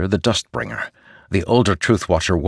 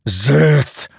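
ringing metallic sword voice; 6 semitones higher, 3 dB louder than usual. Acted. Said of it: "Nightblood demanded".

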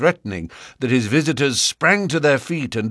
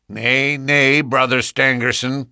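raised, insistent protest; cried out; 4 semitones higher, 3 dB louder than usual. A narrator's reading. Drebber's son crying out against Stangerson, raised voice. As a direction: cut in over the rival suitor. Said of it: "cried the other".

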